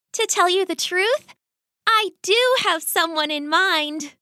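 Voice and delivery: put-on high-pitched young daughter voice; coy rat daughter. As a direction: confess it in a high girlish voice. Daughter's confession voiced in a story.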